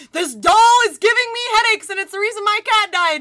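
high, loud imitation of a whining boyfriend; mock outraged complaint. Boyfriend blaming the doll to his girlfriend, mock outrage.

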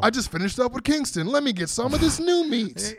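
high-pitched mock eagerness; hammed-up eager high voice; excited wrestler impression. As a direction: play a brawler hungry for the next fight. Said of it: "Yeah, he's like".

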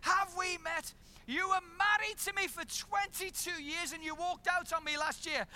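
furious, laying out the betrayal; ex-wife confronting the husband who left, indignant; outraged accusation. Retold or imitated. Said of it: "she said".

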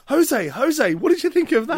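"Jose, Jose" called out high and bright; mock reporter. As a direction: hail him like an eager reporter. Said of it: "the next guy's like".

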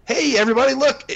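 put-on high, bright-eyed hello to the kids; mock cheery greeting. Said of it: "as if you're kinda being real to your kids".

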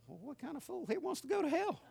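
put-on high, naive boy thinking it over; the child's obvious logic; kid's inner voice. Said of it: "And I thought".

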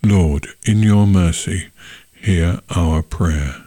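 ordinary delivery

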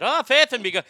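loud, high stage Irish burst; Irish accent. Shown as a demonstration. Host showing off a starter phrase, loud Irish accent. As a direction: launch into an Irish brogue. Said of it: "the Irish accent's not bad".

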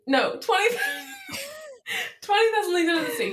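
breaking into high giggles mid-sentence, rushing the title; trying to talk through a fit of laughter; cracking up with laughter. Real, not acted. Telling her mom about the book, cracking up laughing. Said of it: "she's cracking under pressure".

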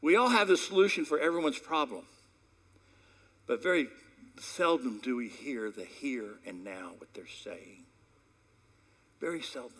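ordinary delivery

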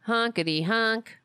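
shouted goofy clown honk; silly clown. Pretend. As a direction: yell it like a clown horn.

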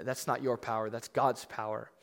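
ordinary delivery

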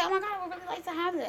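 soft, quick wishful murmur; coaxing hint. Acted. Woman hinting to her man, soft coaxing. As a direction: drop the hint lightly and quietly. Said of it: "say it in my voice".

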